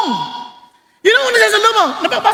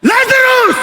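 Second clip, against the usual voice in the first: shouted high "Lazarus!"; loud call; 8 semitones higher, 3 dB louder, slower than usual. Retold or imitated. Jesus calling dead Lazarus out of the tomb, loud high call. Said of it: "Ano sinabi niya?".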